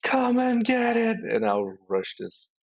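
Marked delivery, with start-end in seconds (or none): 0.0-1.2 high, drawn-out charging yell; battle scream. Acted. Player voicing a charge at an orc, drawn-out scream. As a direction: scream it long and high as a challenge. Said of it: "Uh, just scream".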